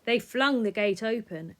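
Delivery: plain reading voice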